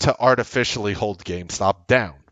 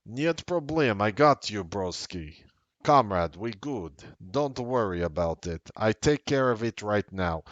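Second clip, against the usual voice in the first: hammed-up Russian accent, agreeing on the phone; comic Russian accent; 4 dB quieter than usual. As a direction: reassure like a mock Russian comrade. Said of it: "do it in a Russian accent".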